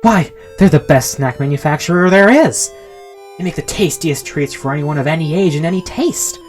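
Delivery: breathless product worship; giddy enthusiasm. A narrator's reading. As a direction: gush like a proud salesman dancing about. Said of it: "while dancing around the room".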